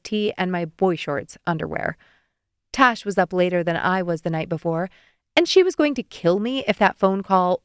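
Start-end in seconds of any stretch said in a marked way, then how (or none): none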